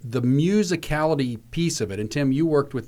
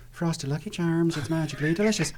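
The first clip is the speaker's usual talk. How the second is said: lilting Irish accent, a touch softer; 2 semitones higher, 5 dB quieter than usual. Shown as a demonstration. Voice actor demos his Irish warm-up phrase, Irish accent. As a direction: slip into an Irish cereal-ad voice. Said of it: "verbally or not go".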